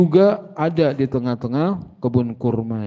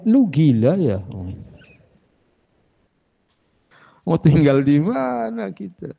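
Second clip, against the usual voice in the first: mild imitated outcry, pitched up; mock wife's yell; 5 semitones higher than usual. Wife objecting to donating the house, mild mock yell. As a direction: protest like an exasperated wife, without really yelling. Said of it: "Mungkin istri kita teriak".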